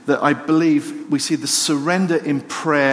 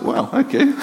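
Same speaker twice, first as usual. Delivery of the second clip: surprised; 8 semitones higher than usual. Retold or imitated. Dog reappears after the prayer.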